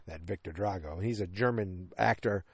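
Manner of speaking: ordinary talk, nothing marked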